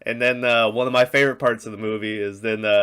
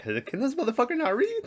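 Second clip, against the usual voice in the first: high-pitched, softer incredulous question; retold disbelief; 14 semitones higher, 5 dB quieter than usual. Viewer baffled that Henry cannot read, high incredulous aside. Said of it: "And I was like".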